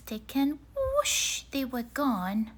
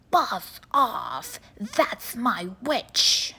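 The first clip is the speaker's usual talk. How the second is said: loud, slow beast snarl; a terrible beast warning off; growling swamp monster; 6 dB louder, much slower than usual. A narrator's reading. Horrible beast threatens dragon.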